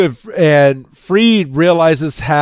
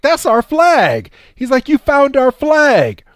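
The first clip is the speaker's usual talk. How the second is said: much higher, thrilled cartoon voice; excited squeal; 12 semitones higher than usual. Freed thrilled to see the lost flag, high squeal. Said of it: "Freed's like".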